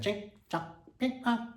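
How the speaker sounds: choppy fake-Chinese syllables; put-on mock Chinese caricature. Comic urging listeners to put their mind to it, mock Chinese. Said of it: "Speak Chinese for half a day".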